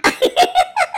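loud laughing burst, voice cracking; burst of laughter. Real, not acted. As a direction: break into helpless laughter. Laughing at stuck student. (忍不住大笑)

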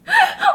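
a sudden high, loud laugh bursting out; high burst of laughter. Real, not acted. Bursts out laughing mid-complaint. (突然爆出一阵又高又响的笑声)

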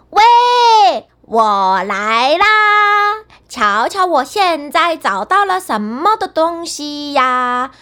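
高声喊, 我来啦拖得很慢; 从后面追着大声喊. A narrator's reading. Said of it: "喊道". (高声拖长喊叫)

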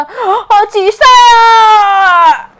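loud, high, desperate calling out; yelling across the room. Acted. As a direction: call out a lost friend's name. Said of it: "喊道着".

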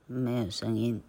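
plain reading voice

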